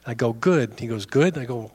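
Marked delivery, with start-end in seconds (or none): 1.0-1.4 puzzled echo. Retold or imitated. Man questioning the pastor's reply, puzzled GOOD.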